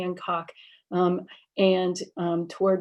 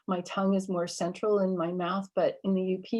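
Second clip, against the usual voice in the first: ordinary talk, nothing marked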